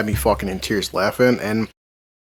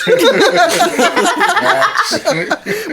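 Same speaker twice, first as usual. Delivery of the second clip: long, high peal of laughter; real laugh; 3 dB louder than usual. Real, not acted. Friend loses it over Al's imagined death, real laughter. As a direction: crack up completely after the punchline.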